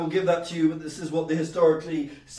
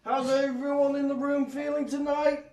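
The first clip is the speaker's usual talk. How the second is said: high, drawn-out slug comic voice; slug comedian; 10 semitones higher, 6 dB louder than usual. Acted. Slug greeting the comedy crowd, high stretched delivery. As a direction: open the set as a slug doing stand-up. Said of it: "if a slug did comedy".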